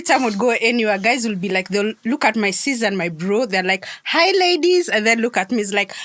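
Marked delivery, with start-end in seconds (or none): 4.0-4.9 imitating men greeting ladies, high-pitched; mock cheery greeting. Guys greeting her sister and brother, mock cheery. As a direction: call it out high, like a flirty greeter.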